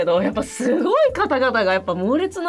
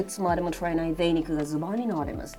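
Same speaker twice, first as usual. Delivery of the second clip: Japanese said like English; English-sounding Japanese, softly; fake foreign language; 6 semitones lower, 8 dB quieter than usual. Shown as a demonstration. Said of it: "あえて英語に寄せて言うとそうなる".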